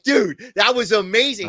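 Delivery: high, loud, delighted praise; excited praise. Real, not acted. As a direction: gush about it. Praising the coworker.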